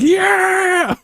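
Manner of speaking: high, loud whoop; sudden high yell; mock cheer. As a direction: yelp it like a startled Brit. Voicing the Brits reacting.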